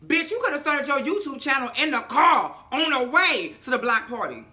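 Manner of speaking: loud, high comic telling-off; playful indignant outburst; exasperated scolding. Acted. Mock scolding of Issa.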